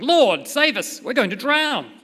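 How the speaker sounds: loud, high, acted plea; urgent cry for help. Disciples wake Jesus in the storm, loud urgent cry. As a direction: call out as if about to go under.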